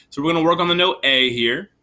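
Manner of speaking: usual speaking voice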